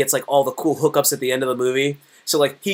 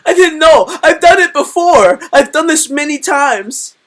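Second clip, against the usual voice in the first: much higher, louder, slow silly voice; mock innocent confession; 12 semitones higher, 11 dB louder, slower than usual. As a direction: play it as a fake-guilty killer, hammed up. Joking confession in high voice.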